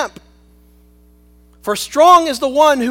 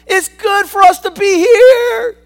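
loud, high, eager Peter gushing; excited Peter; 6 semitones higher, 9 dB louder than usual. Acted. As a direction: shout it like a thrilled disciple with big plans. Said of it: "And Peter answered and said".

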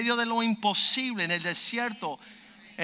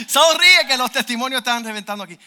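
high, loud, exuberant call; loud, thrilled retelling; excited urging; 6 semitones higher, 12 dB louder than usual. Retold or imitated. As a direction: call out to get a smile amid the excitement. Acted excited urging while taking photo.

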